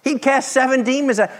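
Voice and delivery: ordinary talk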